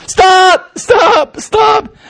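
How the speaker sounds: high shouted stops; panicked shouting. Acted. Prisoner shouting at the shaking walls, panicked. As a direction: yell stop again and again.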